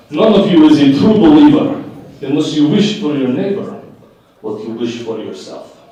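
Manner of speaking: a lightly done mock Russian accent. Quoting a Nation of Islam doctrine, a mild Russian accent. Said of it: "I used a Russian accent for it".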